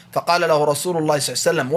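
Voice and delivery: ordinary talk, nothing marked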